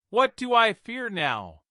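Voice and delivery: high, sharp angry retort; fierce angry cry. A narrator's reading. Nephew angrily answering his calm uncle. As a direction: throw his words back at him in anger. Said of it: "I cried in fierce and angry tones".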